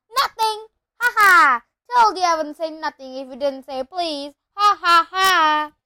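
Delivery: high, very loud crowing with shouted "ha ha"; triumphant mocking taunt; Peeves, gloating. A narrator's reading. Peeves has tricked Filch, gloating at full voice.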